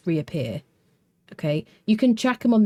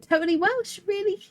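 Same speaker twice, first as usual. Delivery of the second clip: much higher, incredulous question; real surprise. Real, not acted. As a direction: pitch it right up in disbelief. Reacts to chat calling her Welsh.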